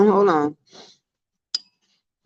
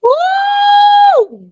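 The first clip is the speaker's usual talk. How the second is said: long high loud "woo"; whoop; 4 dB louder than usual. Real, not acted. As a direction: let out a drawn-out high whoop. Whooping after a long laugh.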